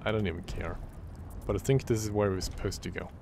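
ordinary talk, nothing marked

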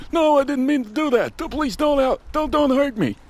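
very high, squeaky begging voice; pleading victim. Acted. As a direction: beg for your life in a tiny high voice. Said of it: "some acting outside".

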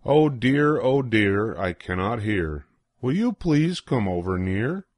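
plain reading voice

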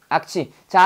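normal talking voice

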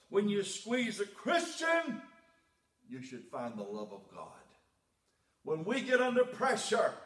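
high, loud climactic preaching; preaching at full force. Acted. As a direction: build the sermon to a shout. Sermon builds to peak.

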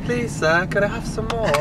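soft, put-on Cockney plea; Cockney accent. Shown as a demonstration. Vlogger answering partner's version, soft mock Cockney begging.

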